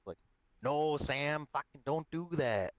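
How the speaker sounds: put-on Alberta hoser accent. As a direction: play up a hockey-bro Alberta accent. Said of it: "Alberta accent".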